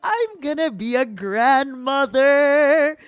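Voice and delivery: very high, loud, slow squealing; excited squeal. Acted.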